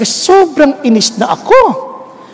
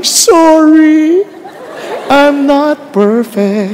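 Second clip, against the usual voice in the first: lightly voiced wife, slowed; wife's tender apology; much slower than usual. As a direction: play the wife relenting gently. Wife turns and answers.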